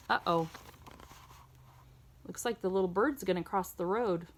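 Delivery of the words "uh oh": plain reading voice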